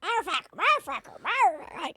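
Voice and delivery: high squawky bird swearing; cussing bird. Retold or imitated. Comic imitating a swearing pet bird, high squawk. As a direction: squawk it like a parrot. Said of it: "He just cusses".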